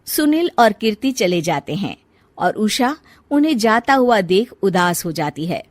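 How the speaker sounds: usual speaking voice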